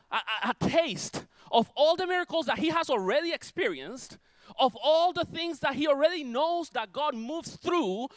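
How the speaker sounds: high, driving preacher's delivery; preach. Real, not acted. Preacher telling the congregation of God's miracles, high and driving.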